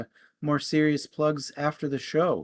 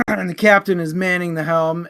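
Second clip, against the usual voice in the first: normal talking voice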